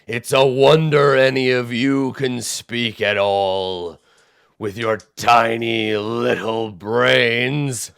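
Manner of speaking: very slow, loud, scornful cyclops; ponderous giant disdain. Acted. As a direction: sneer at puny minds.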